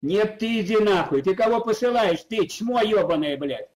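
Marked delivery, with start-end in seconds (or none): none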